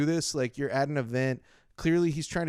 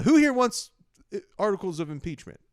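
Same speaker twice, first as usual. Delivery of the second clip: Who here wants said higher and louder, then articles of impeachment rushed; hyping crowd question, pitched up and loud then rushed; mock emcee; 5 semitones higher, 3 dB louder than usual. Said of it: "he says".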